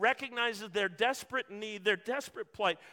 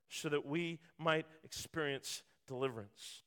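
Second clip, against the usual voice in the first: soft, low and tender; hushed earnest preaching; 6 semitones lower, 5 dB quieter than usual. Real, not acted. Preacher to congregation about the cross, hushed and tender. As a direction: bring them close, gently.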